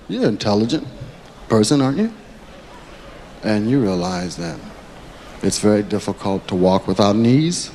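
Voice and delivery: low, soft, polite menace; a calm threat that smiles; smooth mob threat. Retold or imitated. Mobster threatening a comic, low and soft.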